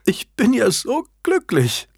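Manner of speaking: high, slow, tearful stammer of joy; overcome, crying with happiness; sobbing stammer. A narrator's reading. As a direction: stammer it through happy sobs, up high. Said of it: "stammelte Annabel schluchzend".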